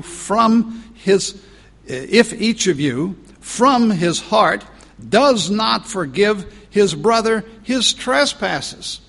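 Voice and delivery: high, loud, slow warning; preaching at full force. Acted. Preacher warning the congregation about forgiveness, slow and loud. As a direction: hammer the warning home word by word. Climax of the reading.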